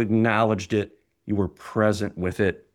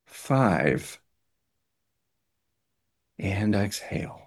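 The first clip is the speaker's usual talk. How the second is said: guided breathing. Acted. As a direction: talk them through each breath. Leads a guided breathing exercise.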